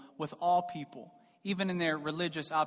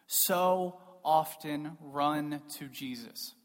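slow, drawn-out delivery with pauses; slow and weighty; much slower than usual. Real, not acted. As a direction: space out "so often run to Jesus". Repeats line slowly for weight.

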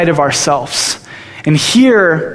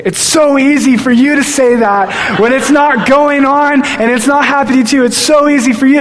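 prideful whine; 8 semitones higher than usual. Retold or imitated. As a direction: snap back at her in wounded pride. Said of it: "I turn to her in pride".

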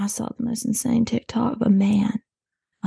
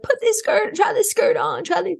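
high, chirpy pushy voice; bossy shop helper; 12 semitones higher than usual. Retold or imitated. Deb bringing skirts to the dressing room, high chirpy nagging. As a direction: hand the clothes over in a squeaky nag.

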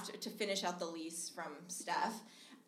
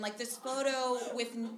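ordinary talk, nothing marked

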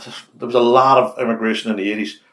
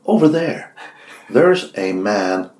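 deliberate, widely spaced phrases, pitched up; slow, spelled-out warning; 3 semitones higher, 6 dB louder, much slower than usual. Acted. Joking about fast talkers slowing down, slow careful warning. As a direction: announce the danger piece by piece. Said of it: "that's how it would roll out".

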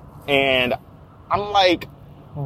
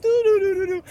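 innocent humming; 14 semitones higher than usual. Pretend. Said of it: "I'm like".

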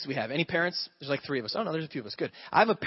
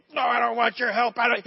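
put-on high, loud defiant child voice; a kid storming off from his dad; imitating a stubborn boy refusing help; angry little kid; 6 semitones higher, 6 dB louder than usual. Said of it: "the kid goes".

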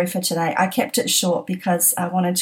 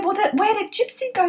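surprised; 13 semitones higher than usual. Retold or imitated. Retelling her own surprised thought.

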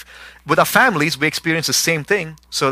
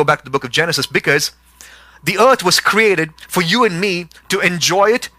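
full-force preaching; 4 semitones higher than usual. Acted. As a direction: drive the point home to the congregation. Sermon builds to full force.

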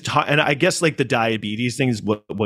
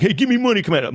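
high mock panhandler demand; typical panhandler; 9 semitones higher, faster than usual. Imitating most homeless dudes asking him, high pushy mock voice. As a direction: imitate a blunt beggar, pitched up. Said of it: "most homeless dudes are like".

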